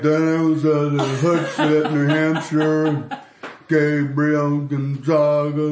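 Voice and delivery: slow, dragged-out ad read; slow droning read. Shown as a demonstration. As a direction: draw out every word of the ad. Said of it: "normally I'm like".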